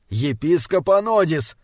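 ordinary delivery, nothing marked

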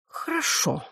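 high, soft sigh of giving in. A narrator's reading. Arthur yielding with a theatrical sigh, sighed. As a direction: sigh it out softly, as if rehearsed. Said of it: "вздохнул Артур".

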